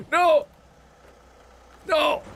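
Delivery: startled, very high-pitched cry of no; screamed no. Real, not acted. Streamer crashes while showing viewers how he drives, startled scream. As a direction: scream in sudden panic as it goes wrong.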